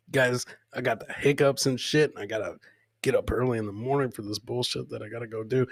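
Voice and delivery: ordinary talk, nothing marked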